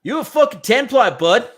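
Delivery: loud, high hammed-up Canadian accent. Co-host rereads the super chat, loud hammed-up Canadian accent. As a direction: ham up a Canadian hockey chirp, loud and high. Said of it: "let me do that in a Canadian accent".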